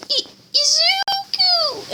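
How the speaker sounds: very high, slow, stammered cry of the name; shocked cry. Acted. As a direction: overcome with shock. Mom sees son alive.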